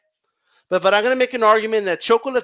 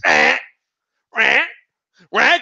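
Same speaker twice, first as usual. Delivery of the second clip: parrot squawk; slower than usual. Retold or imitated. Mocking people who repeat claims, parrot squawks. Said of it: "a bunch of fucking parrots".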